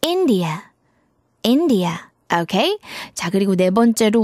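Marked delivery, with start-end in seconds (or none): 0.0-1.9 India with a strong first "IN"; stress on IN. Shown as a demonstration. Teacher models the country name India, stress on IN. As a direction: punch the start of "India", let the rest fall. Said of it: "첫 번째 in 부분을 강하게 발음하면 돼요".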